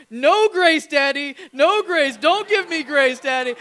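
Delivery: put-on high, very loud childish wail; little girl's protest. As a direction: protest like a stubborn toddler. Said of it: "she starts going".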